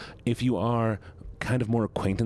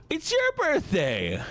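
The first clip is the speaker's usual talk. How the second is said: very high, drawn-out excited squeal; excited birthday cheer; slower than usual. Real, not acted. Streamer celebrates a viewer's birthday, excited squeal. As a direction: squeal it high, stretching the words.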